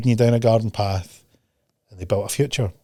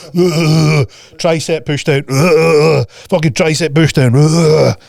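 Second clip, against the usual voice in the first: louder straining grunts between words; mock gym grunts; 3 semitones higher, 4 dB louder, much slower than usual. Coach imitating gym zombies, straining grunts.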